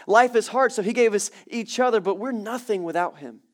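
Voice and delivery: full-force pulpit delivery; preaching at full force. Acted. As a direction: lift it up and preach it loud. Preaching peak.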